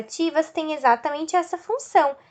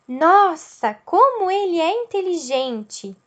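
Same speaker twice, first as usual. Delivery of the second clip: higher, impressed exclamation; admiring surprise; 3 semitones higher than usual. Shown as a demonstration. Teacher showing an exclamation of admiration, impressed and surprised. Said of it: "demonstra admiração, surpresa".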